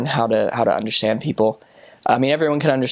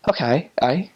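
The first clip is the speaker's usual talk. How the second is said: lightly Scottish, rising to high pitch; a hint of Scots habit; Scottish accent; 7 semitones higher than usual. Shown as a demonstration. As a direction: slip into a Scottish "aye". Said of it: "when I talk to someone who's Scottish".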